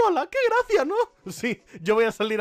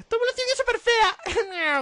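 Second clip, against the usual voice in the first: higher put-on jeer; mock captivating voice; slower than usual. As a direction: needle them in a squeaky voice. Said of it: "Voz cautivadora".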